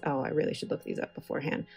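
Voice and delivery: ordinary delivery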